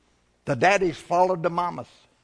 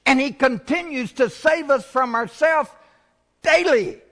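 higher and louder, peaking on "daily"; climactic preaching; 10 semitones higher, 5 dB louder than usual. Acted. Pastor lifting the church to a climax, full preaching voice.

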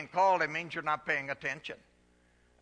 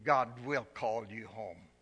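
ordinary delivery

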